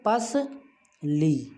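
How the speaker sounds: पस and ली said apart with a pause; syllables split. Shown as a demonstration. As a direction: separate पस from ली clearly. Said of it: "अकेली उसकी ध्वनि जो है ला की वो अलग से आ रही". (पस और ली को रुककर अलग बोलें)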